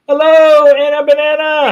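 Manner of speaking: high, loud playful hello; sing-song greeting. Real, not acted.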